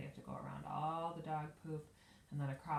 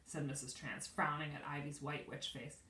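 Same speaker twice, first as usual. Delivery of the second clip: ordinary delivery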